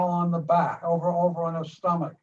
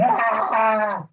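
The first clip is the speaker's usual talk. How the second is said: loud mock yell of attack; sudden loud imitated roar; imitated scream; 3 semitones higher, 5 dB louder than usual. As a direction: act out the doll's lunging yell. Retelling the doll's attack.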